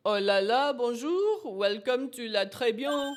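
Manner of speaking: hammed-up French accent, lilting welcome. Restaurant host welcoming guests, French accent. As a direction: greet them in a theatrical French accent. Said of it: "Warning, [name]'s French accent".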